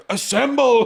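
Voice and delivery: high, loud Avengers-style rally cry; mock battle call. Family women charging in, mock rally cry. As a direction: call the troops like a superhero. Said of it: "like the fucking, do that bit".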